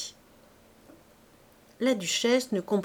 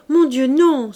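loud, higher cry of protest; alarmed outcry; 5 semitones higher, 9 dB louder than usual. A narrator's reading. Princess protesting to the duchess, loud alarmed cry. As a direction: blurt it out in alarm. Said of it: "s'écria la princesse".

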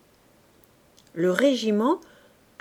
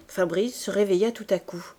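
plain reading voice

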